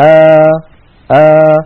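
آ held with the mouth half open, pure a; a clean middle-open آ for the students; open alif. Shown as a demonstration. Teacher demonstrating to students, clean open آ. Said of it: "هكذا، اسمعوني جيدا".

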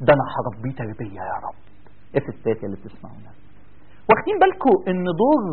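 normal talking voice

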